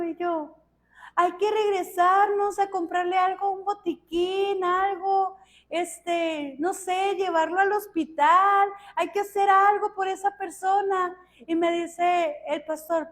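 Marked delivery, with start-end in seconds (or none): 1.2-11.2 high-pitched urging to go back and help; urgent plea. Retold or imitated. Woman begging the pastor to go back for the injured man, urgent plea. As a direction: press the case in a raised pitch.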